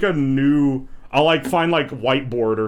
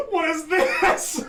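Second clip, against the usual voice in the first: high, slow, laughing question; cracking up at the video; laughing disbelief; slower than usual. Real, not acted.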